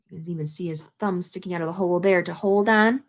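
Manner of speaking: ordinary delivery, nothing marked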